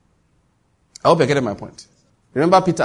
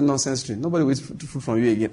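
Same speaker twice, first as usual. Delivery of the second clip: softer, lower offhand grumble; casual dismissal; 3 semitones lower, 6 dB quieter than usual. Retold or imitated. Man brushing off a fruitless tree in passing, casual. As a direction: dismiss it casually, under no strain. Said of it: "looked at a tree casually, just said".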